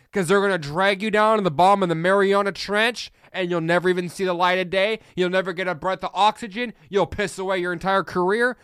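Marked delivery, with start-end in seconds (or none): none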